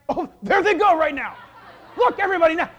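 loud, high cries of amazement; excited calling out. Acted. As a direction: shout to the mourners to look. Acting out excited preacher at graveside.

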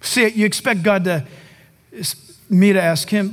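ordinary talk, nothing marked

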